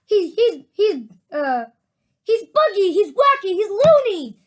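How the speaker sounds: high, loud chorus of insults; frightened shouting. A narrator's reading. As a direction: shout each one in alarm. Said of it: "chorus of frightened shouting".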